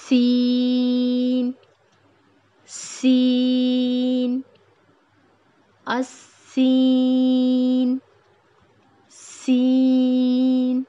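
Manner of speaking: سین with a long hiss, loud and slow, the nasal ن only lightly; hissing seen, drawn out. Shown as a demonstration. Teacher models سین slowly and loudly, long hiss. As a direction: stretch the س hiss, then a light ن. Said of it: "extend کریں گے اور نون پینٹ کر دیں گے".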